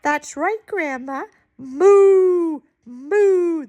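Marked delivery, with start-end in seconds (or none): 1.6-3.7 long, drawn-out moo; cow mooing. Acted. Moo Moo shows Grandma its own sound, cow mooing. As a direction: moo like a cow, proudly held. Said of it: "A cow says moo".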